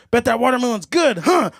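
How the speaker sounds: mock-leering jab. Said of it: "Like that".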